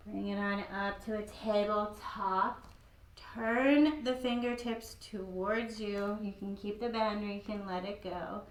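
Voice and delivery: usual speaking voice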